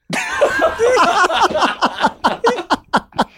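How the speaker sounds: high, bursting embarrassed laugh. Real, not acted. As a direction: laugh it off after flubbing. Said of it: "embarrassed laugh after flubbed line".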